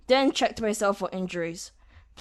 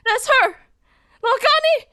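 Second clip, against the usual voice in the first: much higher, louder exclamation; excited shout, much higher; intended tone; 7 dB louder than usual. Shown as a demonstration. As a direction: point her out, thrilled. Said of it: "when I actually do the intended tone".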